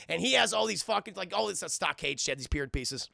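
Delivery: ordinary talk, nothing marked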